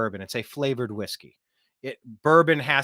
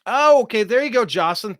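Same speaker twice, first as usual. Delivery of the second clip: surprised; 9 semitones higher, 7 dB louder than usual. Real, not acted. Excited reading a comment.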